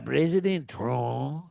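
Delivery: slow, lightly accented mock Zelensky; measured, mock-solemn Zelensky with a Mexican-sounding accent; Zelensky impression. Mocking Zelensky's speeches, slow and lightly accented. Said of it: "Zelensky talks like a Mexican".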